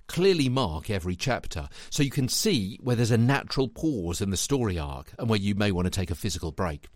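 normal talking voice

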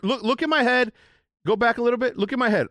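high, loud and worked up; excited. Real, not acted. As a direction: point at the replay excitedly. Excited replay commentary, pointing.

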